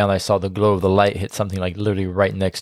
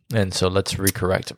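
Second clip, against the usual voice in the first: ordinary talk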